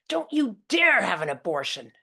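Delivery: loud, high angry thunder; dad thundering. Retold or imitated. Father thundering at his daughter on the phone. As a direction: forbid it with booming fury. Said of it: "Dad thunders".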